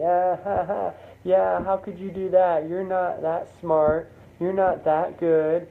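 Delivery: high, jeering classmates with fake laugh; mocking laughter. Classmates point fingers at the presenter, jeering. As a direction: pitch it up and taunt, slowing on the jabs. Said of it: "starts to laugh at you".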